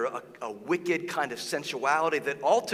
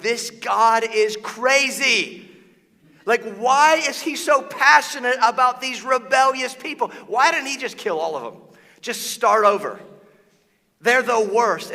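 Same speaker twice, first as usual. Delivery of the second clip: much higher and louder, incredulous rant; exasperated outburst; 9 semitones higher, 9 dB louder than usual. Retold or imitated. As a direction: throw up your hands at a God who won't quit. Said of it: "you're like".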